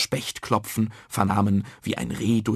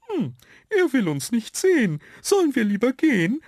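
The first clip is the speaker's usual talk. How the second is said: very high put-on creature voice; high, louder storybook figure; little yellow dot asking; 13 semitones higher, 4 dB louder than usual. A narrator's reading. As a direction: squeak the question like a tiny sprite. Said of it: "fragte ein gelber".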